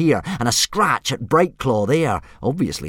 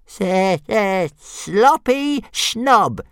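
high, very slow singsong jeer; jeering dragon; 11 semitones higher, 3 dB louder, much slower than usual. Acted. Dragon jeering at Fireworm, high and drawn out. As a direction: drag out the taunt mockingly. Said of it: "jeered Toothless".

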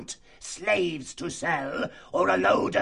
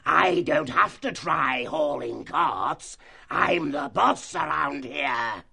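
higher, slow, shouted fox tirade; furious fox chief; 8 semitones higher, slower than usual. Acted. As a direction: dress him down with slow fury.

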